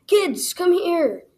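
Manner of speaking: high, loud, drawn-out summons; shouted call. A narrator's reading. Weird tall man calls his kids over, shouting. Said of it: "shouted the man".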